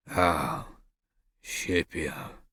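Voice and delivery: low grudging grunt of a name; gruff, unimpressed. A narrator's reading. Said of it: "Victor grunted".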